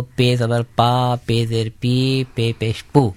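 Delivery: پے زبر پا، پے زیر پی، پے پیش پو recited clearly; a teacher sounding out پا، پی، پو one at a time; زیر زبر پیش کی مشق; spelling drill. Shown as a demonstration. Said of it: "پے کو".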